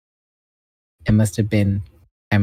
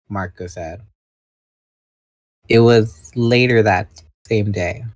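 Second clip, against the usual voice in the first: plain reading voice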